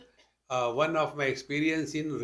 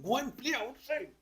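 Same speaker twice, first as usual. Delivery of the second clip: nagging parent; 8 semitones higher, 6 dB quieter than usual. Retold or imitated. Parent voiced scolding children.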